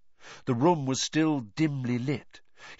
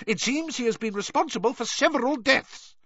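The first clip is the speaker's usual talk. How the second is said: high pompous bluster. A narrator's reading. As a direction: wave the death away as unimportant. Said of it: "blustered Fudge".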